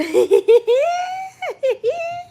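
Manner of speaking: high loud laughing fit; scream-laugh; shrieking laughter. Real, not acted. Cracking up.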